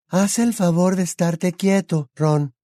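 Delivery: high, slow airy voice; Luna whispering. A narrator's reading. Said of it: "susurró Luna".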